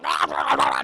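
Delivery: loud, low monster gobbling; repeated savage guttural bursts; imitated devouring noise. The witch throws herself at two kids, with low gobbling roars.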